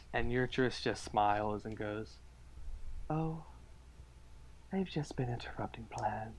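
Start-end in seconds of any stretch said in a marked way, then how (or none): 3.1-6.3 smiling, sly whisper; smug whisper. Acted. GM voices Yurtris smiling.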